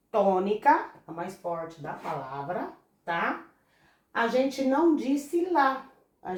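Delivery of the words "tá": usual speaking voice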